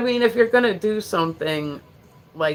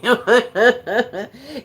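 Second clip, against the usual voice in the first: laugh; 6 semitones higher, 6 dB louder than usual. Real, not acted. Laughs at Jersey impression.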